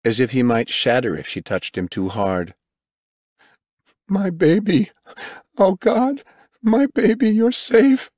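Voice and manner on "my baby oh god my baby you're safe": very high, overwhelmed outcry; tearful relief. A narrator's reading. Father finding his daughter alive, crying out. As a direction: cry out high, overcome with relief. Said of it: "His tears of sorrow turned into tears of relief".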